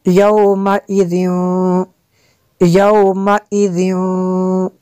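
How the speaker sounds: chanted "यौमइज़िन" ending in a held nasal w; ghunnah. Shown as a demonstration. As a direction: hum out the last sound of "यौमइज़िन", drawn long. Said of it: "यहाँ पे भी गुन्ना करेंगे".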